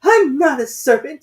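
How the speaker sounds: high, loud, offended protest; indignant. A narrator's reading. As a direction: snap back, insulted. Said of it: "said Alice indignantly".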